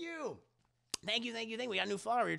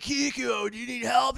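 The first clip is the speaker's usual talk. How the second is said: Kuwabara voice; 6 semitones higher, 6 dB louder than usual. Acted.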